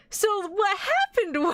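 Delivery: high-pitched words breaking into real laughter; laughing. Real, not acted. Streamer explaining the mix-up to viewers, laughing too hard to finish. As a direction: crack up halfway through the sentence.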